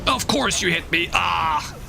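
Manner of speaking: high-pitched cry of frustration; exasperated shout. Real, not acted. Gamer just hit by an enemy, exasperated shout. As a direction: shout it in exasperation, ending in a yell.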